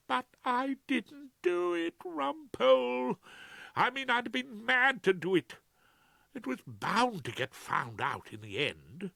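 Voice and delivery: high, fretful Claude voice; Claude, anxious. Acted. Claude frets to Rumpole, high and anxious. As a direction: voice a nervous barrister pleading.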